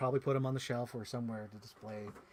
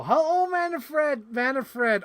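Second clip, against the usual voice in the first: excited greeting; 10 semitones higher, 8 dB louder, much slower than usual. Real, not acted. Greeting a chat viewer.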